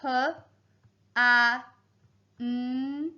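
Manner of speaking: "p, a, n" as isolated phonics sounds; sounding out letters. Shown as a demonstration. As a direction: spell "p, a, n" by sound, pausing between. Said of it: "And I'll spell it".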